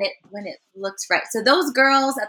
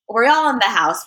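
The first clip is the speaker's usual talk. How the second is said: ordinary talk, nothing marked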